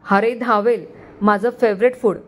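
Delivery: ordinary delivery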